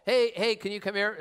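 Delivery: acted-out attempt to flag someone down; reasonable call for attention. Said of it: "first it goes reasonable".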